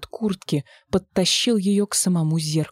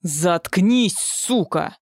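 growling; much slower than usual. A narrator's reading. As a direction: snarl at her viciously. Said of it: "зарычала псевдо-Лиза".

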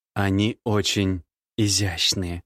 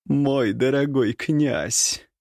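slow, higher catlike purr; purring woman; 4 semitones higher, much slower than usual. A narrator's reading. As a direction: purr it like a cat. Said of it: "промурлыкала она почти по-кошачьи". (промурлыкать по-кошачьи)

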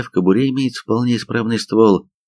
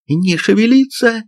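very high-pitched wailing order; howled command; 13 semitones higher than usual. A narrator's reading. Sergeant senses a provocation, howling an order. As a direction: howl it out in alarm. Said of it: "взвыла старшина".

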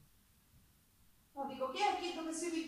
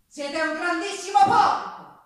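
insulto; 5 semitones higher, 10 dB louder than usual. Acted. Insulting the priest.

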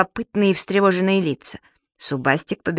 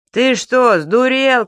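loud, higher jeering yell; rude shout; 6 semitones higher, 5 dB louder, much slower than usual. Acted. A man in a tracksuit yells at Mr Peppermint, rude shout. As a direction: heckle the man on the post. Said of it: "крикнул ему какой-то мужчина".

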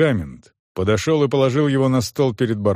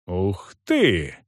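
mock admiration; 3 semitones lower than usual. A narrator's reading. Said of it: "притворно восхитился барон".

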